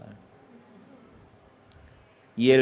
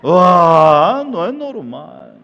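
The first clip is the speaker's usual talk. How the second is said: normal talking voice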